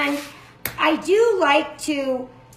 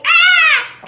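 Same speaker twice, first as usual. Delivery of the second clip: high, loud "Ah!" of mock horror; mock shriek; 9 dB louder than usual. Followers objecting to canola oil in the recipe, mock shriek. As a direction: imitate a follower's alarmed scream. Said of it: "some followers that say".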